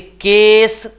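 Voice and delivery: केस stretched long on a high, loud note; model pronunciation. Shown as a demonstration. Teacher says it for students to repeat, model pronunciation. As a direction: draw out the "ay" in केस. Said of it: "बोलिए केस".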